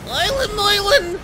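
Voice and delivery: put-on high Yiddish-accented call. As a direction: sing out the name with a Yiddish lilt. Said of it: "very good Yiddish voice".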